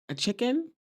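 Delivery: high rising mock surprise on "chicken"; surprised question. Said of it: "Morello looks up like".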